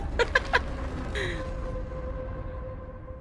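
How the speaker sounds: long, high-pitched laughter; helpless giggles trailing away; high giggling laugh. Real, not acted. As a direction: let the laugh run on and thin out. Laughing at the showcase.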